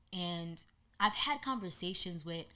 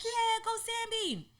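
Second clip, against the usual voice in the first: cheering; slower than usual. Retold or imitated. Group cheering her.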